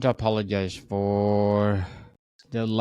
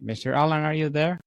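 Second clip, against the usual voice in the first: raised, higher calling voice; 7 semitones higher, much faster than usual. Real, not acted. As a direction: call out to check he's there. Host checks on dropped guest.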